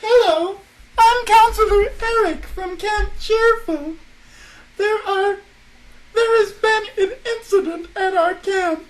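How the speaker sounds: high voice on the phone; camp counselor voice. Acted. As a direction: play a chirpy camp counselor.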